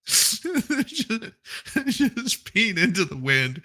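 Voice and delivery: high, slow words through real laughter; breaking up laughing. Real, not acted. Joking about cicadas peeing, breaking up laughing. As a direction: stretch the words out between giggles.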